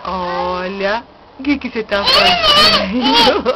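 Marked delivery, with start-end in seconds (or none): 1.4-3.4 singsong "fazendo" drawn out; playful sing-song question. Real, not acted. Grandma teasing toddler.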